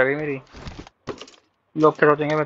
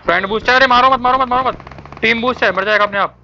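high, urgent repeated calls; urgent warning; 7 semitones higher than usual. Real, not acted. Gamer telling teammate not to shoot, urgent high warning. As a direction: stop a teammate in a hurry, pitched up.

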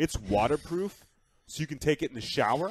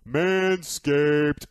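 loud, slow hyped ad announcement; sudden sponsor read; 6 semitones higher, 6 dB louder, much slower than usual. Acted. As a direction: boom out the sponsor name. Sudden jump into ad.

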